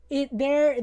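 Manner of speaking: normal talking voice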